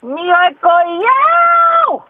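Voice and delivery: high drawn-out shout; shouting impression. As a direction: belt it out, stretched long. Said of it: "야, 샤우팅이 굉장하네".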